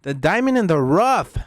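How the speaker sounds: high, slow booming hype yell; hype shout. Acted. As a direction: belt it out like a ring announcer. Hyping his favourite wrestler.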